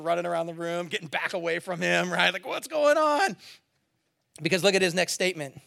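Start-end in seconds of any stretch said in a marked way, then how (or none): 2.4-3.4 mock panic. Disciples backing away in panic.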